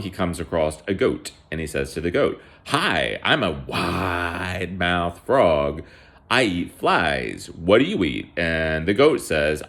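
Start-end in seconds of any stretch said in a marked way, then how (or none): 2.7-8.3 slow, stretched-out frog greeting; cartoon frog introducing itself, slowly; wide-mouthed frog. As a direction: draw out the WIDE like a storybook frog.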